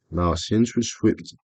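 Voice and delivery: ordinary delivery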